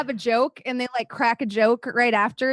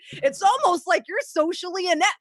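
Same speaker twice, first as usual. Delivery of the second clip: mocking, raised and higher, building sarcasm; sarcastic tirade; 6 semitones higher, 4 dB louder than usual. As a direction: drag them with cutting sarcasm, climbing higher. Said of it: "And I fucking went in".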